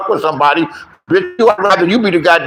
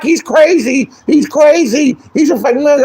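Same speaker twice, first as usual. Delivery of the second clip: mock listeners; 9 semitones higher than usual. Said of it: "They're like".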